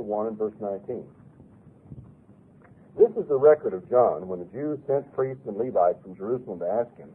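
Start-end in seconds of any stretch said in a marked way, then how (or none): none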